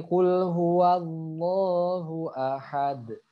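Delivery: slow held chant, "ahad" with echoing D; bounced final D. Shown as a demonstration. Teacher repeats the model verse, slow with bounced D. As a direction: recite "huwallahu" long, release the D of "ahad". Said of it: "Jadi harus dipantulkan ketika sukun".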